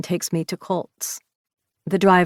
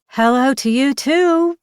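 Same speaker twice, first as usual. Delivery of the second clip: slow, lazy drawled greeting, pitched up high; 9 semitones higher, 5 dB louder than usual. A narrator's reading. Woman drawls a sarcastic hello to her grumpy friend over the intercom. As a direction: drag the words out with teasing sarcasm. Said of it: "I drawl".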